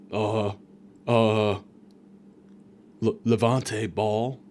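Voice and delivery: slow, low, halting mumbler; soft, slow groggy fumble for a name; mock dazed patient. Dazed patient giving nurse a false name, slow and halting.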